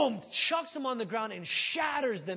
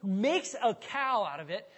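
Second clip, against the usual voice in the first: usual speaking voice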